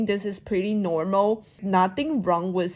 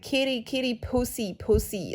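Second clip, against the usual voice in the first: teasing schoolboy imitation; 4 semitones higher, 4 dB quieter than usual. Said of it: "I remember one of the guys".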